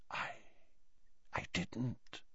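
very soft hushed reply. Acted. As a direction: say it hushed, in shock. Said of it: "said Slughorn in a hushed voice".

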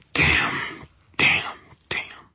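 breathless whispered curse; frightened whisper. A narrator's reading. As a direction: hiss the curses in panic. Terror at laser sight.

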